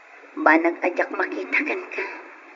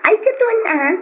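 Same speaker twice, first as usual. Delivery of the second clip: high loud delighted exclamation; excited squeal; 12 semitones higher, 5 dB louder than usual. Acted. Woman gushing to her friend, high excited squeal. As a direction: squeal with glee.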